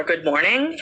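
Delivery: ordinary delivery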